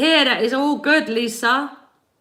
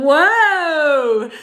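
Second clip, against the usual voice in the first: excited whoa; 5 semitones higher, 6 dB louder than usual. Real, not acted. Excited exclamation to viewers.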